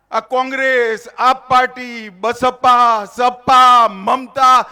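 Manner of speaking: ordinary delivery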